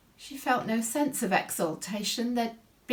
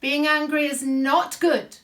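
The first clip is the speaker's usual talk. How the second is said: louder insistent denial; 6 semitones higher, 7 dB louder than usual. Acted. Heated closing claim.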